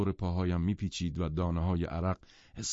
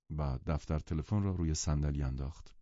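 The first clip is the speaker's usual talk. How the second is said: ordinary delivery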